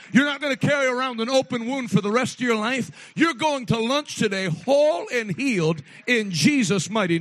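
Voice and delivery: loud, driving pulpit preaching; preaching at full force. Acted. Pastor preaching loud to the congregation. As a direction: preach it hard to the whole room.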